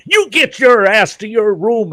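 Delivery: high, loud scolding yell; furious dad yelling at his son. Retold or imitated. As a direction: shout him off to his room. Chewing son out, finger cut.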